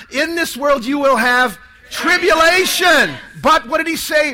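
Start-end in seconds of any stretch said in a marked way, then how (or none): 0.1-3.1 loud chanted line, raised; louder. Shown as a demonstration. Priest urging the crowd to repeat, loud chant. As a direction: belt it out with the congregation. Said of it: "let's say it louder".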